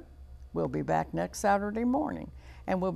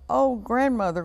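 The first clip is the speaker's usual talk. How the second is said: higher, louder startled exclamation; crying out; 6 semitones higher, 7 dB louder than usual. A narrator's reading. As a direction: cry out in storybook surprise.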